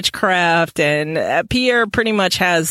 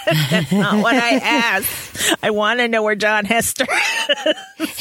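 laughing; 4 semitones higher than usual. Retold or imitated. A child arguing with her guardian, laughing. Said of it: "like a child arguing with a guardian".

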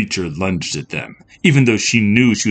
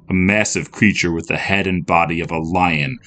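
plain reading voice

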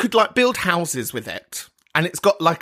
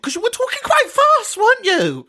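camp teasing; 4 dB louder than usual. Acted.

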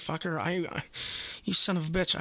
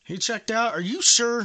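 high, loud disbelief; mock surprise; 6 semitones higher, 8 dB louder than usual. Caller demanding proof from the hotel clerk, incredulous. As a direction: pounce on it in disbelief.

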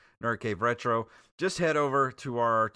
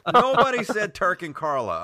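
louder mock outrage, playful; mock anger; 7 semitones higher, 7 dB louder than usual. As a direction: scold them jokingly, louder. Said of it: "I'm so mad".

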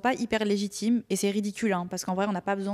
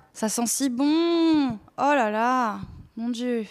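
savouring delight; 3 semitones higher, 4 dB louder, much slower than usual. Real, not acted. Savouring the smell of tea.

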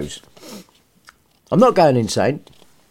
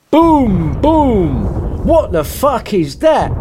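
put-on startled kid; 4 semitones higher, slower than usual. Maz startled by the battle, mock alarmed outburst. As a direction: jump at the blast, then swear in shock. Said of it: "thought Maz".

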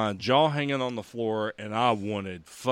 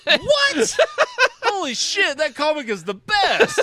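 excited; 7 dB louder, slower than usual. Real, not acted. Shocked by comic spoiler.

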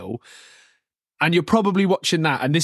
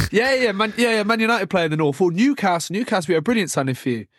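higher, quick reassurance from a mock agent; lightly voiced agent, higher and fast; eager agent; 6 semitones higher, faster than usual. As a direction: rattle off the clubs like a keen agent. Agent answering player.

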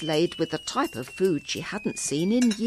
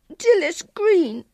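put-on very high, louder, slow tearful girl; sobbing child; 12 semitones higher, 7 dB louder, slower than usual. A narrator's reading. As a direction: wail the name as an acted tearful child. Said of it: "she sobbed".

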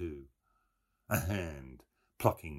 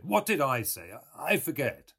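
bright, louder mocking young man; Buck Mulligan voice; 8 semitones higher, 7 dB louder than usual. A narrator's reading. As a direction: Mulligan answering Stephen, raised and loud.

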